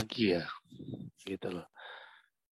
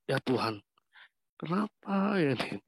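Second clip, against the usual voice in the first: higher-pitched, distressed appeal to God; retold anguished plea; 6 semitones higher than usual. Patient alone after the phone call, unable to breathe, pleading. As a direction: relive a helpless plea, voice rising high.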